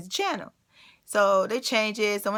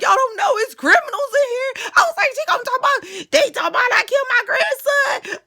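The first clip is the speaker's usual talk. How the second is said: high, loud sobbing shout; crying; 7 dB louder than usual. Retold or imitated. Crying on the phone about the criminals inside, loud sobbing shout. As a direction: wail it through tears in panic. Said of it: "I was crying so hard".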